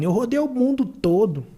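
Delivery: high, loud, scornful mock rant as the rude man; arrogant rant. Rich man scorning the beach vendor, loud scornful rant. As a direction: look down on him and sneer loudly. Man insults the water seller.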